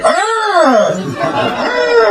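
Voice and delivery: high-pitched drawn-out mock shriek; mock scream. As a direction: imitate a friend recoiling with a high scream. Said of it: "and he was like".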